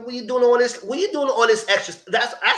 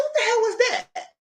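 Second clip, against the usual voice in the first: surprised. Real, not acted. Reacting to bed scene.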